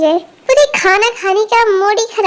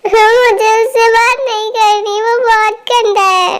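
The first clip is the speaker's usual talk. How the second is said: put-on crying child; 2 semitones higher than usual. Little girl complains to aunt about brother, crying. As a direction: sulk through acted sobs. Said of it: "रो मत".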